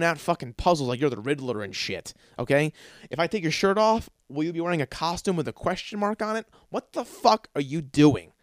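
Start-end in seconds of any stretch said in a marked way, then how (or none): none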